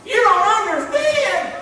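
high, whiny put-on excuse voice; mock a whiner making excuses; mock excuse maker. Preacher mocking a listener's excuse, high and whiny. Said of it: "You can make excuses till the cows come home".

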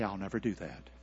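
low and very soft; subdued caution. Shown as a demonstration. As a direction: drop the voice right down. Subdued repeat of the warned phrase.

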